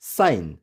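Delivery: साइन as one syllable, no g; correct, silent g. Shown as a demonstration. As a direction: say साइन with long i straight into n. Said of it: "right pronunciation होगी".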